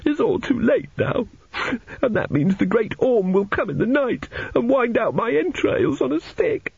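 put-on very high, tearful child's wail; sobbing boy. A narrator's reading. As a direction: wail like a small boy dreading his doom. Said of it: "sitting on the edge of his bed and sobbing".

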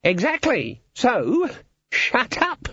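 very high, crowing voice; triumphant guild boss. A narrator's reading. Guild boss silencing the objector, menacing triumph.

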